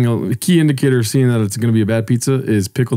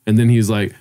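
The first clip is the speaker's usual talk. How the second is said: ordinary delivery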